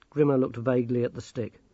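plain reading voice

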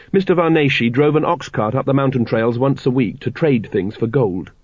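plain reading voice

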